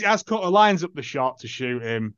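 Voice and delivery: ordinary talk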